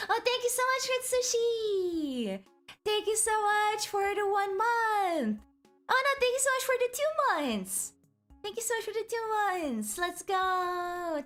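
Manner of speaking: high, sweet thank-you voice; cute anime streamer. Acted.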